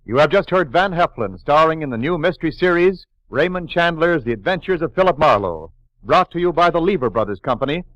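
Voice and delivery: ordinary delivery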